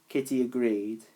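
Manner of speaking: plain reading voice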